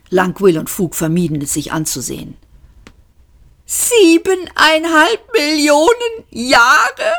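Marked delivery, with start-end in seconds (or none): 3.7-7.1 high, slow, disbelieving cry of „Siebeneinhalb Millionen Jahre?“ rising at the end; shocked shout. A narrator's reading. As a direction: yell it in outraged disbelief. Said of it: "schrien sie im Chor". (hoher, fassungsloser Schrei)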